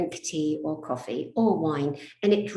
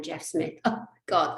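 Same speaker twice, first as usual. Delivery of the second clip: normal talking voice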